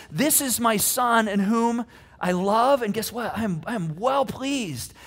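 ordinary talk

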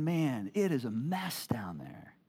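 slow, hushed whisper; whispered aside. Acted. God looking down on humanity, slow hushed whisper. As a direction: breathe it out like a sigh at the mess.

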